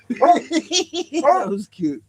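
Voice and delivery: high, very slow, laughing words. Real, not acted. Laughing about the dog's reaction, high and slow. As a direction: let it dissolve in laughter.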